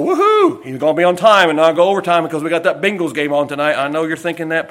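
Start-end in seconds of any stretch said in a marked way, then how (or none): 0.0-0.6 sudden high cheer; excited whoop. Real, not acted. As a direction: whoop it up, playful. Cheering before the last point.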